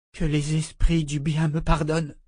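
ordinary delivery, nothing marked

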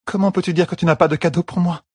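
high, touched protest; tearful Elaine. A narrator's reading. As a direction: protest through tears.

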